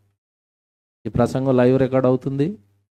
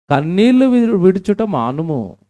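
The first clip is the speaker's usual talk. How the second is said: slow, loud and much higher; fervent scripture proclamation; 7 semitones higher, 6 dB louder, slower than usual. Acted. Preacher urging weeping girls, slow and loud. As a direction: stretch the verse out as a loud cry. Verse repeated with force.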